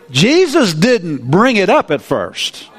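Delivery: ordinary talk, nothing marked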